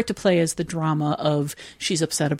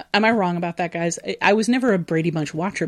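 normal talking voice

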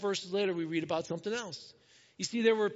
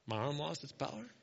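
low, soft deadpan question; God, mock incredulous; 4 semitones lower, 7 dB quieter than usual. God teasing Moses over his doubt, quiet mock incredulity. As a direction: echo the doubt quietly in disbelief. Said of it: "I love how God's like".

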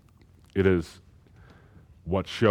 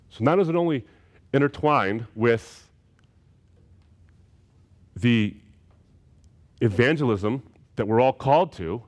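louder, higher, ringing preacher's climb; full-force preaching; 4 semitones higher, 4 dB louder than usual. Real, not acted. Preacher pressing the congregation on persecution, fervent full preaching. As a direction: preach it out with rising fervour.

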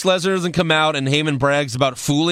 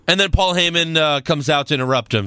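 ordinary talk

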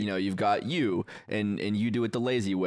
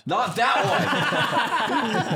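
laughing; 10 semitones higher, 5 dB louder, much slower than usual. Real, not acted. Laughing protest at idea.

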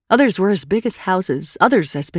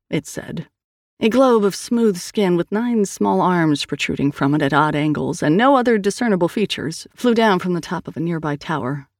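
usual speaking voice

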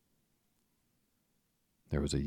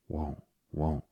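put-on mildly naughty child's refusal; naughty cry. A narrator's reading. Michael refusing his medicine, a mildly naughty cry. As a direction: refuse with a touch of childish defiance. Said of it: "Michael cried naughtily".

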